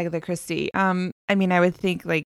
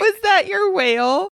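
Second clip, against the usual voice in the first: laughing tease; 11 semitones higher, 5 dB louder than usual. Real, not acted. Laughing teasing a friend.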